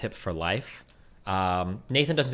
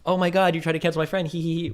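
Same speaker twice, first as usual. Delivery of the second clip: high, loud mocking singsong ending in giggles; mocking taunt; 8 semitones higher, 4 dB louder than usual. Mocking the taunt aimed at Adriana, high loud singsong with giggles. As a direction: parody a gleeful taunt. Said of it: "It's not funny to be like".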